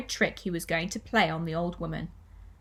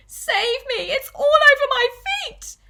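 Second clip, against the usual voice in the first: high shriek building to a piercing peak; panicked scream; 9 dB louder than usual. Acted. Wife begging to be saved from the creature, panicked scream. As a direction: scream for rescue, louder and louder. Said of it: "screamed Missus Twit".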